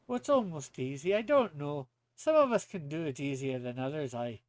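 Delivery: put-on Scots brogue; Scottish accent. Coaching friend on nailing the accent, in a Scottish accent. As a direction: boast lightly in a Scottish accent. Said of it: "nail the accent".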